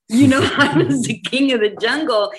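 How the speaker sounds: high, loud laughing boast. Real, not acted. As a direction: laugh it out like a proud lion king. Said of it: "laughing at Mufasa self-image".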